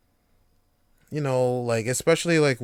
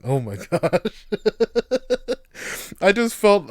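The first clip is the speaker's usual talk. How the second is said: ordinary talk, nothing marked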